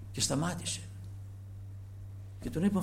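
normal talking voice

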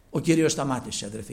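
normal talking voice

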